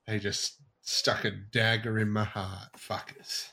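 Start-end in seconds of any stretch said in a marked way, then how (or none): 2.8-3.5 whispered "Fuckers" under his breath; muttered curse. Real, not acted. Gamer whispering a curse about the missing Marvel game. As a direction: breathe the curse out, barely voiced.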